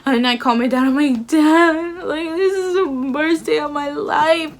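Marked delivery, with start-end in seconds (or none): 1.3-4.5 mock sobbing, high-pitched wail; exaggerated tearful whine, voice pitched up; retold crying. Daughter phoning dad after being turned away, crying theatrically. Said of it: "I'm crying so hard".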